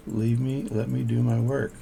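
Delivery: ordinary talk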